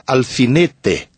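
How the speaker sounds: Paraná accent, "alfinete" with a full hard "te" at the end; sotaque do Paraná. Shown as a demonstration. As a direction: say "alfinete" the Paraná way, final "te" not softened to "chi". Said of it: "no Paraná você falaria". (sotaque paranaense, "alfinete" com "te" final bem pronunciado)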